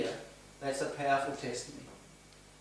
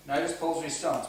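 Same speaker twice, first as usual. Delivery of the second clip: ordinary delivery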